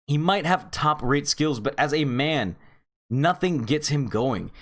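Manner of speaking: normal talking voice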